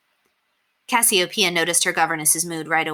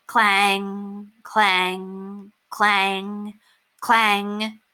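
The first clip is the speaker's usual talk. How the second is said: slow ringing clang, clang imitating a church bell; 3 semitones higher, much slower than usual. A narrator's reading. Narrator imitating the distant church bell, ringing clangs.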